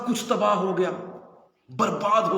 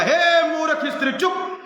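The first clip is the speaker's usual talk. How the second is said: sharp raised rebuke, pitch much higher; Job rebuking wife; 10 semitones higher, 4 dB louder than usual. Retold or imitated. Job silencing his wife, sharp high rebuke. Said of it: "पर अय्यूब ने कहा".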